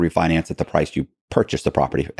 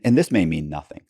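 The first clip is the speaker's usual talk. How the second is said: usual speaking voice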